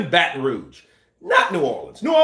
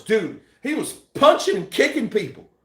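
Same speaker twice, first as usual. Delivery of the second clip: ordinary talk, nothing marked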